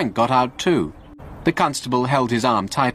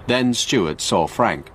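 usual speaking voice

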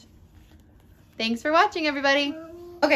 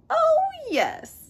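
high, showy dragon voice; proud dragon. Acted. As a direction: puff up with pride. Boastful dragon agrees.

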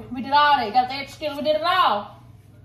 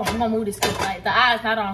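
ordinary talk, nothing marked

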